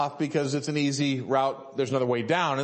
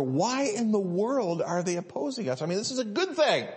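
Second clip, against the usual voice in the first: higher-pitched, mildly incredulous questioning; mock bewilderment; 6 semitones higher than usual. Believer wonders at opposition to good work, bewildered protest. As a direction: voice a baffled believer, pitch climbing to the end. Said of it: "sometimes you wonder".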